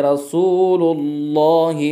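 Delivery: slow रसूलुल्लाह with a heavy, full lam; full Allah demo. Shown as a demonstration. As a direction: say रसूलुल्लाह full-mouthed and drawn out. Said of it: "पुर पढ़ेंगे".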